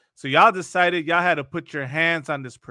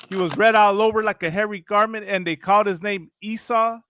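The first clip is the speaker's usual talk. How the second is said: normal talking voice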